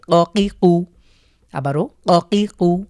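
অকিকু slow and loud, ক pushed hard; emphatic qaf. Shown as a demonstration. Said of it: "কফের উচ্চারণে ধাক্কা দিয়ে পড়তে হবে". (ধীরে জোরে অকিকু)

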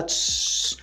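unvoiced tss of a burning fuse; fuse hiss. Shown as a demonstration. Teacher imitating a dynamite fuse burning, unvoiced hiss. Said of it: "E fica chiando".

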